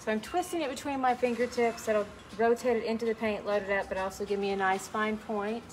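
normal talking voice

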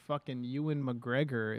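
usual speaking voice

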